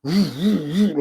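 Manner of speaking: a moaning woo woo woo for a creaking floor; lightly imitated floor creak; imitated floor groan. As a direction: voice the floor flexing as a mock moan. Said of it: "you heard the floor go".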